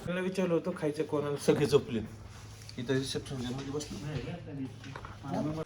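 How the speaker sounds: whispered so sleepers stay asleep; hushed whisper; quiet at night. Real, not acted. सगळे झोपले.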